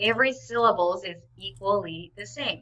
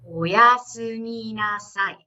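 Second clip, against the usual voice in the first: "Oyasuminasai" drawn out slowly; slow practice; 3 dB louder, slower than usual. Shown as a demonstration. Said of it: "saying it slowly first".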